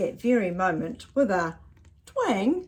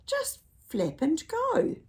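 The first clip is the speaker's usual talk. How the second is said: owl voice; 10 semitones higher, slower than usual. Acted. Owl boasting in story.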